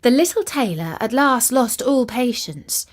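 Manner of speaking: plain reading voice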